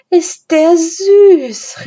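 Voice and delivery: high, loud, drawn-out gush; delighted cry. A narrator's reading. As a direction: squeal slowly over something adorable. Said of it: "rief Anne begeistert".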